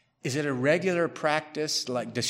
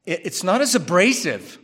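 usual speaking voice

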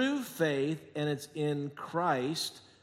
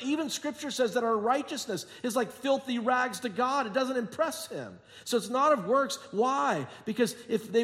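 fervent preaching; 6 semitones higher than usual. Acted. Preacher building the point.

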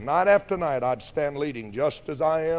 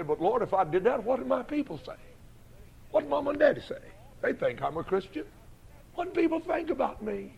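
much higher, pleading, worried questions; anxious plea to God; 8 semitones higher than usual. Retold or imitated. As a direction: beg the Lord, frightened of what people think.

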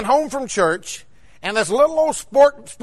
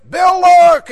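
sudden high, loud cry of alarm; alarmed warning yell; 8 semitones higher, 10 dB louder than usual. Retold or imitated. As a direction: yell a warning as the wife in the car. Wife's warning before the crash.

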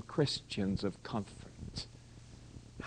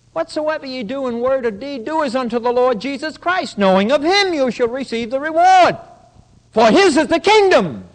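full-force preaching; 7 semitones higher, 10 dB louder than usual. Real, not acted.

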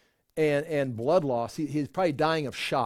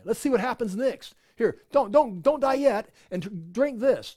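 higher-pitched, pressing plea; urgent soldier; 6 semitones higher than usual. Acted. Soldier to dying Jesus with a drink, urgent. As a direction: act out an anxious urging to hold on.

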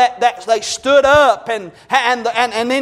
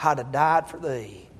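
low, soft, grieving lament for his son; a hint of David's weeping; weeping king; 5 semitones lower, 4 dB quieter than usual. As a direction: voice David mourning Absalom, hushed. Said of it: "David wept and said".

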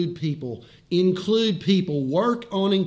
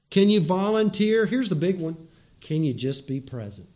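ordinary delivery

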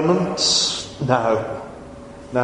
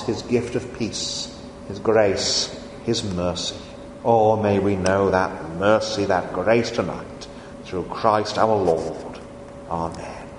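lower, hushed benediction fading to Amen; closing prayer; 6 semitones lower, 4 dB quieter than usual. Acted. Preacher closing the communion service in prayer, low and hushed.

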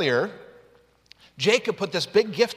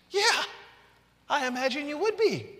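wry agreement; 8 semitones higher than usual. Real, not acted. Wry reaction to Jacob's fear.

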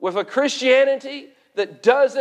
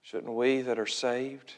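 low, very soft pulpit appeal; hushed and grave, leaving long pauses; solemn preaching; 10 semitones lower, 8 dB quieter than usual. Real, not acted. Preacher urging the saved to pray for laborers, hushed and solemn.

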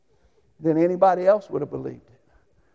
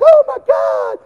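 high, loud mock shriek of alarm; 12 dB louder, slower than usual. Wife seeing brothers head outside to fight, shrieked. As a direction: squeal it like a scared wife. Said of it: "Diane's like".

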